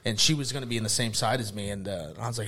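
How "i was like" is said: usual speaking voice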